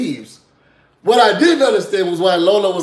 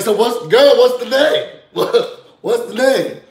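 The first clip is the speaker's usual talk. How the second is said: higher, louder imitation of pestering; loud demand; 6 semitones higher, 5 dB louder, slower than usual. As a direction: press for an answer, half laughing. Retold host loudly demanding the name.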